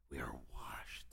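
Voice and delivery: breathy hushed whisper of the line. Shown as a demonstration. Pastor whispering the truth to his congregation. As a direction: whisper it close, barely voiced.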